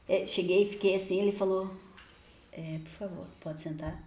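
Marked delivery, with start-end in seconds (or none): 2.5-4.0 quiet, politely offering a seat; polite demon voice. Retold or imitated. Demon politely invites the speaker to sit, soft and courteous. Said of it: "Ah, educado".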